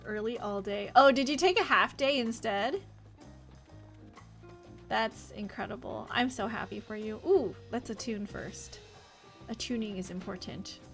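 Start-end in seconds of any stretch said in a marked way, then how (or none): none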